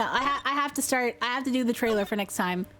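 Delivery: ordinary delivery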